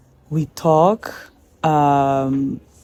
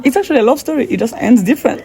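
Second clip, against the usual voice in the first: laughing delight; 7 semitones higher, 4 dB louder, faster than usual. Real, not acted. Laughing about her sad song.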